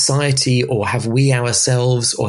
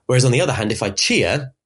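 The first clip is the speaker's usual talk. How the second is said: ordinary talk, nothing marked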